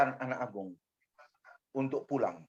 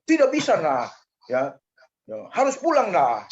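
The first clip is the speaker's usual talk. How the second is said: mock Japanese accent, higher and louder; Japanese accent imitation; 3 semitones higher, 6 dB louder than usual. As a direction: play the Japanese commander in a hammed-up Japanese accent. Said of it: "logat, dialek, eh, intonasi dari orang-orang Jepang".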